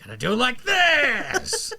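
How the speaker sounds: hammed-up cartoon battle cry; Captain Ginyu. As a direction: stretch it into a showy, rising shout. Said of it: "Captain Ginyu had the most awesome voice".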